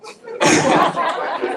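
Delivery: sudden high laugh at the AI's attempt; amused burst of laughter. Real, not acted. Presenter laughing at the AI's Jamaican accent, bursting out. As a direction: laugh out loud, delighted.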